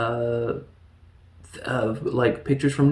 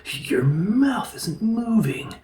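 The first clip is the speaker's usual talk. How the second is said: slow, high, breathy whisper; hushed, acted bewilderment at a strange sight; astonished mock whisper; 9 semitones higher, much slower than usual. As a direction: whisper it in drawn-out disbelief. Acts man startled by telepath.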